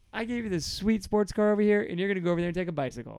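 high, loud, incredulous slam; mock indignation. God slamming Israel for trading down, high indignant voice. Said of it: "big slam".

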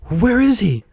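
high, urgent puppet voice; eager puppet. A narrator's reading. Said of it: "asked Pinocchio quickly".

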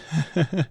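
bright helpless giggle; giggling fit. Real, not acted. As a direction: giggle you can't hold back. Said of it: "Oh, I can't stop laughing".

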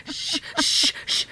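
insistent run of loud shushes. Retold or imitated. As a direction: shush harder so it carries from afar. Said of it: "getting louder the further I get away".

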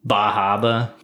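put-on Boston accent, "Bahaba" without any R's; Mark Wahlberg. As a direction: imitate a New England local. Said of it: "What else does Mark Wahlberg say".

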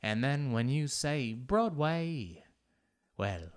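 slow, drawn-out theatrical accent; Broadway accent. Shown as a demonstration. Drunk performer keeping the accent going, showy Broadway accent. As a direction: hold the put-on accent, stretching every phrase. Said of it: "make sure that you keep the accent".

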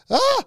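high, loud startled shriek; startled scream. Retold or imitated. Man caught in the shower, startled scream. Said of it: "She's like ... that was me".

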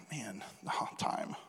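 breathy whisper to himself. Real, not acted. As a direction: mutter it under your breath. Realizes he's out of time.

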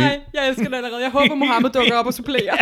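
loud, laughing enthusiasm; delighted laughter. Real, not acted. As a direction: gush through real laughter.

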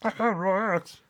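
high, whiny nerd; squeaky, eager nerd voice. Retold or imitated. Mocking Titus's nerd voice.

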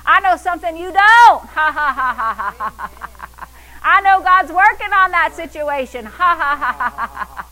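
loud crowing lines breaking into laughter; gloat, then let the laugh tumble down; laughing taunt. Shown as a demonstration. Believer taunting the devil about God working, gleeful laughing. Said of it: "A believer starts laughing".

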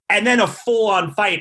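ordinary talk, nothing marked

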